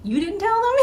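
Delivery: high, stunned "you didn't tell them?"; stunned disbelief. Retold or imitated. Recalling her shock that he hadn't told the managers, high stunned disbelief. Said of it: "stunned 'cause I was thinking".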